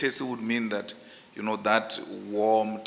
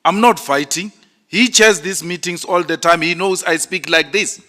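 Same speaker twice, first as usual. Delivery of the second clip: loud, higher indignant insistence; shouting; 7 semitones higher, 9 dB louder than usual. Real, not acted. Defending his tone to the chair at the commission, loudly. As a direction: hammer home that this is just how you talk. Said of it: "you are shouting now".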